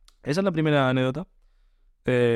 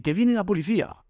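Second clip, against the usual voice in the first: urgent warning; 8 semitones higher than usual. Retold or imitated. Friend warning police coming.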